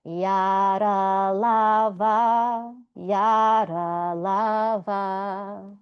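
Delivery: "yara lava" stretched out very slowly, each syllable drawn long; teacher modelling the semivowel line for students; slow demonstration. Shown as a demonstration. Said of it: "So this line would go".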